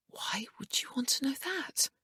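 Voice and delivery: high, hushed, frightened whisper; distressed whisper. A narrator's reading. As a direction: pull back in alarm, breathless and high. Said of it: "Emma cried, immediately distressed".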